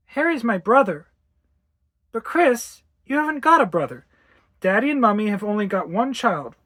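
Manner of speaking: lightly voiced mother and insistent child; mild argument. Mother corrects child about imaginary brother, insistent exchange.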